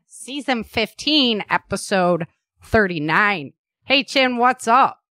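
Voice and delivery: podcast intro. Real, not acted.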